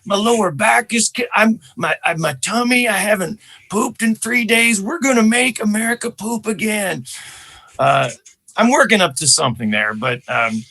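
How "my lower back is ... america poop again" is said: high, raspy presidential impression; Joe Biden. Comedian playing a president consoling people over loss, high raspy voice. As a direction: rasp it like Clint Eastwood with a bit of Owen Wilson. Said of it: "Joe Biden is a bit raspy as well".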